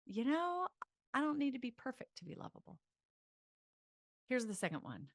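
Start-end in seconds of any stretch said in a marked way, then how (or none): 0.1-2.8 light brushing-off. Shown as a demonstration. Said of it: "Instead, it's like".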